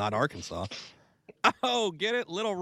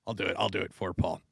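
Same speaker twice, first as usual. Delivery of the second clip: ordinary delivery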